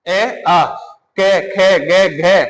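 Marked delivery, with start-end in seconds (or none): 1.2-2.4 कै खे गे घे drawn out slowly, each sound apart; slow sound demo. Shown as a demonstration. Teacher shows students where sounds come from, slowly. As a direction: stretch every sound of कै खे गे घे. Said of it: "इसमें किसकी सहायता जा रही है".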